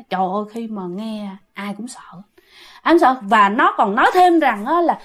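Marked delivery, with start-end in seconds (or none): 0.1-2.0 near-whispered, lowered aside; hushed dread. Retold or imitated. Storyteller tells how all who heard it were scared, hushed. Narrator relays everyone's fear at revelation.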